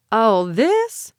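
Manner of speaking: smug Mississippi drawl, lightly done; a hint of a sneering Ole Miss accent; snide Ole Miss drawl. A narrator's reading. Benny taunting Rhonda about his badge, in a light Ole Miss drawl. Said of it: "with his snide Ole Miss drawl".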